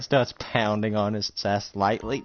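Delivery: ordinary talk, nothing marked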